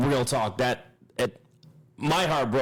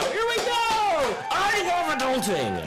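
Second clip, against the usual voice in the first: high, slow, singsong sarcasm; mock cheer over the expense; sarcastic; slower than usual. Sarcastic about an eight-grand bill.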